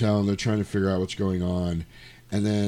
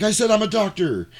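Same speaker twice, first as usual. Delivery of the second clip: much higher, exasperated protest; mock outrage, pitched way up; indignant insistence; 12 semitones higher, 4 dB louder than usual. Doctor brushed off by the men, indignant.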